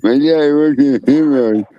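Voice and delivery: tongue held, slurred words; holding own tongue. Shown as a demonstration. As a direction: say it while pinching your tongue. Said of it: "hold your tongue and say".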